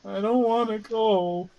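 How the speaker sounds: put-on high, slow, pleading child voice; Buddy. Child begging mom not to let him be taken, high plea. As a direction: plead weakly in a small high voice. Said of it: "he's like, "Mom".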